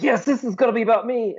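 high, eager cartoon hero voice; excited Robin. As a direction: squeal it like a thrilled sidekick. Robin voiced while retelling the show.

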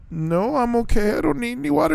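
small squeaky hesitant no; mock uneasy refusal. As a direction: imitate politely backing away. Retold baffled, timid refusal.

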